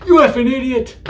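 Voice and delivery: mock rage at an idiot; angry insult. Shown as a demonstration. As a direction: bark the insult like you mean it. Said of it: "baka is more like".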